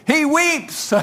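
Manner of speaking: high, giggly, barely getting it out; a real laugh breaking the line; laughing through it. Real, not acted. Preacher still cracking up about Bev, laughing.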